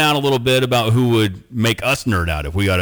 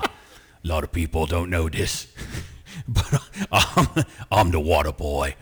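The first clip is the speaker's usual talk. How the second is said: lower, slow tough-guy British voice, laughing through it; a deadpan hard-man confession; Jason Statham impression; 5 semitones lower, 4 dB quieter than usual. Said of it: "he might as well be like".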